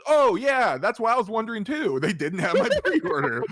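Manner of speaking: high, loud sarcastic cheer, laughing on the end; loud mock-cheerful sarcasm. Telling the new clerks what happened, loud mock-cheerful sarcasm. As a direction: sell it with over-the-top fake brightness. Sarcastic retelling to other store.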